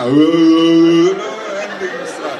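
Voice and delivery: dazed. Acted. Dazed at the therapist meeting.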